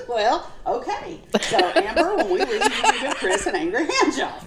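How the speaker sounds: high laughing tease. Real, not acted. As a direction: tease her while laughing. Laughing at the joke.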